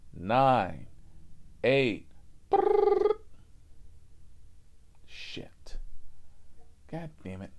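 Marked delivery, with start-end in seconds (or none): 2.5-3.2 high buzzing ring; phone ring. Retold or imitated. Phone ringing before answer the phone. 5.0-7.5 whispered under breath; whispered curse. Real, not acted.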